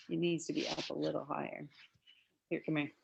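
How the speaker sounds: softly, off to the side; quiet aside. Real, not acted. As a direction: murmur it aside to one person.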